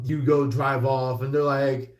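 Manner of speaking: normal talking voice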